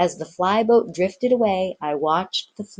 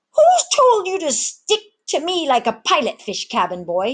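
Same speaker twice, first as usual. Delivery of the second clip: much higher, louder puffed-up snap; a mate brushing off a clinging boy; annoyed rebuke; 11 semitones higher, 4 dB louder than usual. Acted. Ginger rounds on the cabin boy, higher and sharp.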